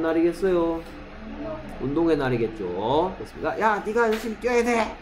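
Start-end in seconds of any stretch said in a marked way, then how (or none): 3.6-5.0 fast, high-pitched mock yell; high, hurried shout in a put-on voice; acted shouting. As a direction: play someone yelling at a runner. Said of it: "나에게 소리를 지릅니다".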